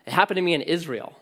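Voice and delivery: ordinary talk, nothing marked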